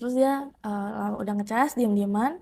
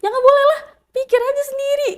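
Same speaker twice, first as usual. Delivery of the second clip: high, loud retold protest; raised-voice indignant comeback; indignant refusal; 10 dB louder, faster than usual. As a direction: refuse sharply, voice jumping up high. Retelling her indignant refusal.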